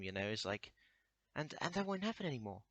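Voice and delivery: ordinary talk, nothing marked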